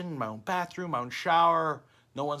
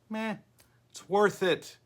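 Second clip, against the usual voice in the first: usual speaking voice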